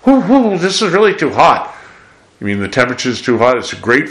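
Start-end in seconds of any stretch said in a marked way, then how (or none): 0.1-1.8 hammed-up yelp of a diner burnt by hot food, much higher and louder; mock burnt mouth. Preacher acting out a diner's surprise at hot food, mock yelp. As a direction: play someone blowing on food that's too hot. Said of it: "sitting down for a wonderful meal and saying".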